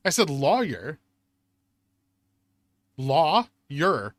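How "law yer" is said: Law-yer split in two clear syllables, law then yer. Shown as a demonstration. Teaching chat that Law-yer has two syllables. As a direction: break Law-yer into two beats, falling. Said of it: "It's two syllables".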